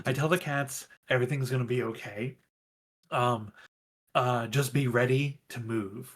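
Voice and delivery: usual speaking voice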